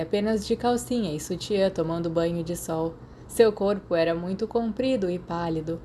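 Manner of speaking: plain reading voice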